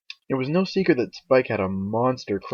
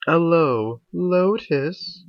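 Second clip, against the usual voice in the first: higher, louder sing-out greeting; calling out; 7 semitones higher, 5 dB louder, much slower than usual. A narrator's reading. Rarity greeting the spa owners, calling out. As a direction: call brightly across the room. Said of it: "Rarity called out".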